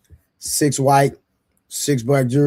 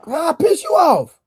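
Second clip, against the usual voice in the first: incredulous; 11 semitones higher than usual. Real, not acted. Incredulous comeback.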